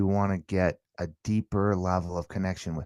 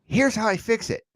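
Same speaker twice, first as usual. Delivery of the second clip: mock fix-it parent; 12 semitones higher, 5 dB louder than usual. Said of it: "Do you go".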